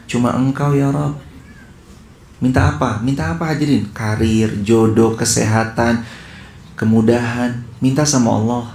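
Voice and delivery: ordinary delivery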